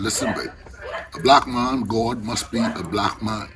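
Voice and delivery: ordinary delivery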